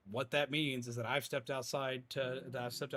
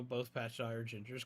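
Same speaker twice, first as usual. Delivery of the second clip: usual speaking voice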